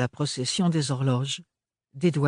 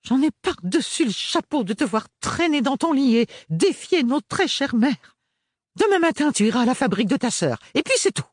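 much higher and louder, laying down the law; shrieking scolding; 12 semitones higher, 4 dB louder than usual. A narrator's reading. Mother ordering Ophélie to the factory, angry scolding. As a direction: scold her, no arguments allowed.

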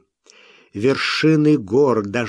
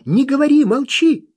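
urgent alarm; 6 semitones higher than usual. A narrator's reading. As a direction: press them urgently to stay silent. Lobiskvi warns urgently.

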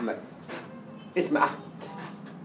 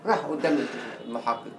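ordinary talk

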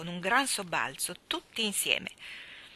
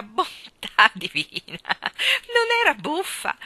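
louder, slowing, breaking into laughter; laughter pushing the voice higher to the end; laughing while talking; 4 semitones higher, 9 dB louder than usual. Acted. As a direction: scold her through helpless giggles. Mister Wigg laughing, scolding fondly.

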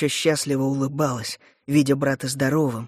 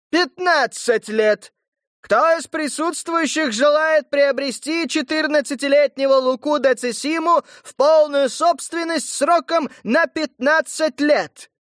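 slower emphatic auction call; town crier; 12 semitones higher, 4 dB louder than usual. Acted. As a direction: stress the new fifteen years. Herald repeating with new terms.

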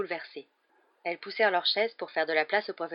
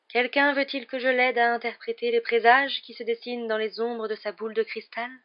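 Trelawney's mystic voice; 5 semitones higher, 5 dB louder than usual. A narrator's reading. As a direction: intone it like a dreamy seer. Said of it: "murmura-t-elle".

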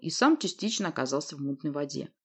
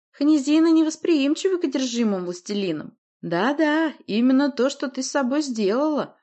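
high, raised gloating monster; smug monster; 9 semitones higher, 5 dB louder than usual. A narrator's reading. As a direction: explain it with gloating ease. Voices monster Рыг in story.

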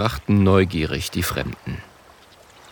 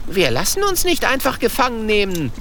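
put-on high, indignant young dragon protest; outraged, refusing to be captured; 13 semitones higher than usual. A narrator's reading. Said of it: "Empört ruft Kokosnuss".

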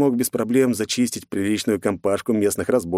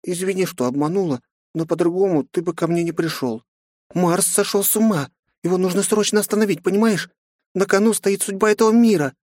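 put-on high child's voice, rushing; rapid little girl; 11 semitones higher than usual. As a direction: babble urgently like a small girl. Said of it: "тут же затараторила она".

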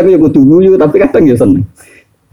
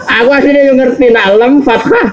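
ordinary talk, nothing marked

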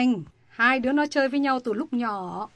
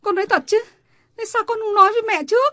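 higher-pitched, startled questioning, the second line fast; shocked question; 9 semitones higher, 4 dB louder, faster than usual. Acted. Mother hearing her daughter has a lover, shocked. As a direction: demand answers in disbelief.

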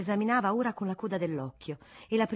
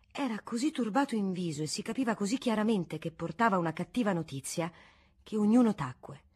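usual speaking voice

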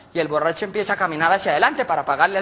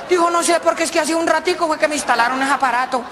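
high character voice; 8 semitones higher than usual. Acted. Character explains the radio.

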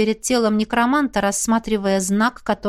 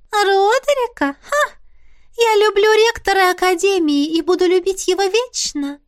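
put-on high, scornful ghost girl voice; haughty ghost girl; 10 semitones higher than usual. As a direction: scoff it out like a lovesick teen ghost. Missy the ghost scoffs.

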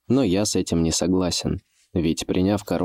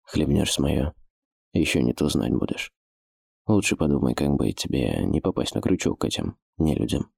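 low, hushed thief voice; a hint of a wary old thief; 5 semitones lower than usual. Thief voice warns.

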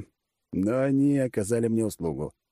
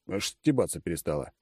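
ordinary delivery

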